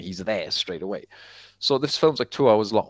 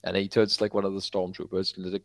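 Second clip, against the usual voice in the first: usual speaking voice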